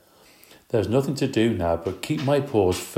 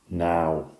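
stern; 3 semitones lower than usual. Acted. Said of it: "she said in a very stern voice".

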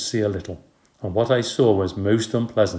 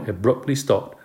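usual speaking voice

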